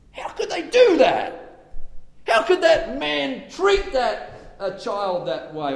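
high, slow scandalised mimicry; hammed-up high indignation; mock self-righteous outrage. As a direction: imitate the judging crowd. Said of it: "see how terrible that is".

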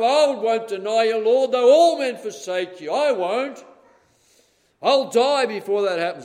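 loud, high, insistent vow of loyalty; proud loud promise; bold vow. Acted. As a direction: Peter swearing he'll never deny Lord. Peter boasting he won't deny.

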